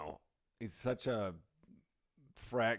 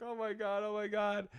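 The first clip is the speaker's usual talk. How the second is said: overwhelmed groom; 8 semitones higher than usual. Retold or imitated.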